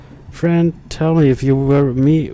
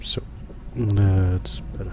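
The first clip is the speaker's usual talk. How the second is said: slow unvoiced whisper; 5 semitones lower, 7 dB quieter, slower than usual. Real, not acted. Streamer settling his setup, whispering slowly. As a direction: breathe the words out slowly.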